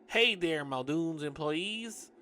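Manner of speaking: slow, put-on hearty hail; overzealous greeting. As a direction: call out a drawn-out, over-keen hello. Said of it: "giving an overzealous wave".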